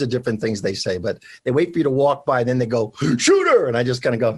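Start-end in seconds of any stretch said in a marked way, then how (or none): 3.2-3.7 high, loud called-out nickname; street call-out. Retold or imitated. New York passerby calling after him, high shout. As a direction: call it at his back as he walks by.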